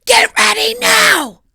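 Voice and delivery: big mock yell at the kids; shout. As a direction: bellow it, drawn out and high. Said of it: "I just said".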